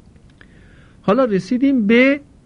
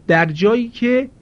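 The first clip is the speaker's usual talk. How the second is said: usual speaking voice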